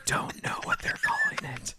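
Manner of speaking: unvoiced whispered aside; muttering a joke aside to the co-host. Real, not acted. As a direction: drop to a breathy secret whisper. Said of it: "whispered aside".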